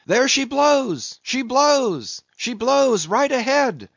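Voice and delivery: high, drawn-out lookout's call; long high sung-out shout; masthead cry. A narrator's reading. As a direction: call the sighting down to the whole deck. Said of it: "was now the masthead cry".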